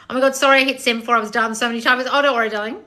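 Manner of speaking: usual speaking voice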